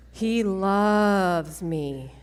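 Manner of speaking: slow, hushed and tender. Real, not acted. Speaker to crowd about the Lord's love, tender and slow.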